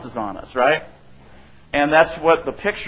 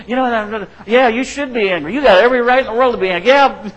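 higher, louder, fast egging-on; 8 semitones higher, 6 dB louder, faster than usual. Retold or imitated. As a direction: fire them up, take their side hard. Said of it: "get other people to take our side and say".

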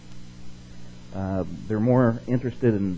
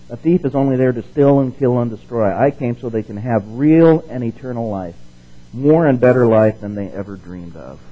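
louder Bible reading; scripture reading; 5 dB louder than usual. Acted. Preacher reading verse ten to the congregation, louder. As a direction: read it out with conviction. Reads the Message version.

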